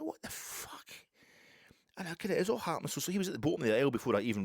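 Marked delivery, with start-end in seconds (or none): 0.0-0.9 hushed, voiceless retold disbelief; stunned whisper. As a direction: whisper it as a shocked aside. Own stunned reaction, retold.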